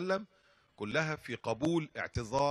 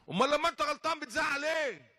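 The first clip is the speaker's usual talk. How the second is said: mock yell; 13 semitones higher than usual. Sheikh yelling back at the driver, playful mock yell. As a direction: shout back in jest. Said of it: "لازم ازعق شويه برضه انا كمان".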